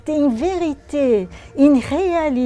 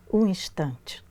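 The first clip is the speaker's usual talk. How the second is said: softly whispered ending; a hushed whisper to close the phrase; 5 semitones lower, 7 dB quieter than usual. Acted. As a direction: let the line fade into a whisper. Whispered close of a poem line.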